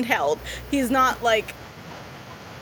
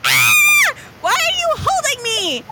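high, shouting frightened robot; panicked squeaky robot voice; scared little robot; 11 semitones higher, 4 dB louder than usual. Acted. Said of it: "Okay, one moment".

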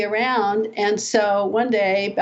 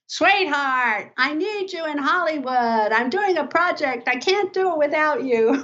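much higher, coaxing producer voice, charming her in; imitating Jerry; 8 semitones higher than usual. [name] imitates Jerry Weintraub begging her to come to Hollywood, higher and coaxing. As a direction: sweet-talk her like a big Hollywood producer on the phone. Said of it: "he's like".

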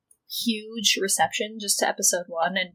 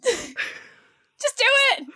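high, drawn-out playful shout; mock urging yell; much slower than usual. Cohost urging partner to burp, mock urging yell. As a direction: egg them on with a squealed yell.

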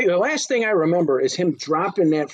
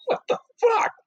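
incredulous. Real, not acted. Disbelieving outburst.

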